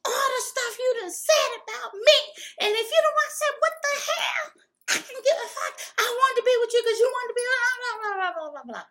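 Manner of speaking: high, fast, raging shout; furious yelling. Retold or imitated. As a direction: go off on him. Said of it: "And I said".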